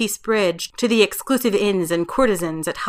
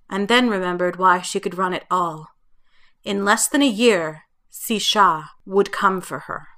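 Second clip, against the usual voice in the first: plain reading voice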